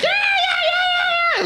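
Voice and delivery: shrieking imitation of a wild classroom; very high mock kiddie cheering; rowdy kids. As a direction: squeal like a pack of rowdy children. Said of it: "The kids were all rowdy".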